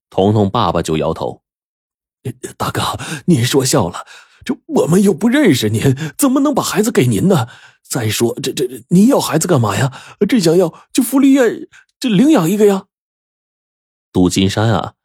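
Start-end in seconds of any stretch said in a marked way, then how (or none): 2.6-12.9 high, stammering, flustered objection; nervous protest. A narrator's reading. Father refuses to hand over his child, nervous protest. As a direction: protest timidly in a climbing pitch.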